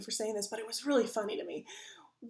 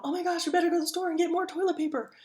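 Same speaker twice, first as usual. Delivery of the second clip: fast, higher, louder panicked rush; mock panic; 6 semitones higher, 6 dB louder, faster than usual. Shopper freaking out over toilet paper, mock panic. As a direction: blurt it like a hoarder in a panic. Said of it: "we're freaking out".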